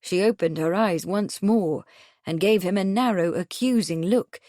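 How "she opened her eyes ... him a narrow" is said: ordinary delivery, nothing marked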